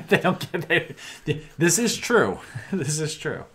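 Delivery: laughing, high and loud. Real, not acted. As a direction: laugh it out fondly. Laughing at disciples' confusion.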